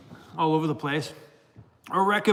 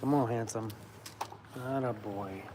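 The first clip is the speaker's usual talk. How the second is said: praising the dog; 5 semitones lower, 10 dB quieter than usual. Real, not acted.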